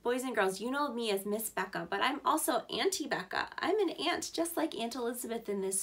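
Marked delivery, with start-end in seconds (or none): none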